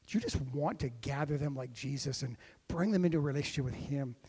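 normal talking voice

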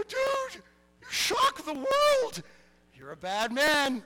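huge high-pitched amazed holler; re-enacted yell of astonished praise; excited shouting. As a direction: shout it sky-high, stretching the words. Re-enacts his amazed cheer at the boy.

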